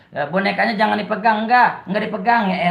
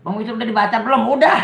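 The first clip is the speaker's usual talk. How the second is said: ordinary delivery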